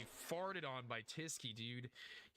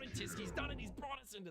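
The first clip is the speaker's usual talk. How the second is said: excited call; 8 semitones higher than usual. Real, not acted. Caster calling Tisky's clutch, rising excited call. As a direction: sell the round win.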